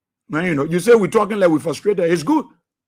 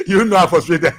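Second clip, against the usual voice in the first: lower, chuckled words; laughing; 5 semitones lower than usual. Real, not acted. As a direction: say it through leftover laughter. Still laughing at own frustration.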